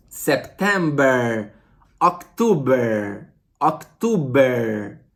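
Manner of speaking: October lento como "októbe", R final suave; pronúncia inglesa lenta. Shown as a demonstration. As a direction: estique October bem devagar. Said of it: "vamos trabalhar October". (professor treina October com a turma, devagar)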